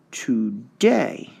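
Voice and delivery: Today drawn out, rising on DAY; stress test on Today. Shown as a demonstration. Teacher sounding out stress on Today. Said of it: "one you really have to hear".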